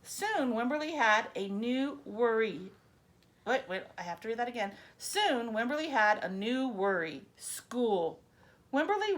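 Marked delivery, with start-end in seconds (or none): none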